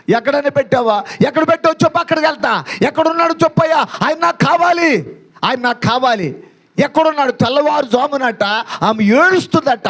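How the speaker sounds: high, insistent pleading declamation; impassioned preaching. Acted. Preaches at full pitch. (ఎత్తైన స్వరంలో ఆవేదనతో ప్రాధేయపడుతూ ప్రసంగం)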